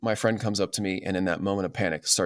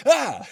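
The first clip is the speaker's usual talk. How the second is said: put-on child's scream. Child overwhelmed by question.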